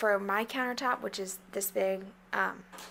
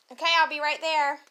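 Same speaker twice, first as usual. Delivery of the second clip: calling out; 5 semitones higher, 8 dB louder than usual. Real, not acted. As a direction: call out to the next room. Calling out to her daughter.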